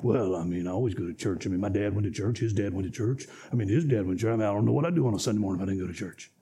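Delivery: lazy churchgoer shrugging it off, fast at the end; low put-on voice of a shrugging churchgoer; habitual churchgoer. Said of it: "Why do you come?".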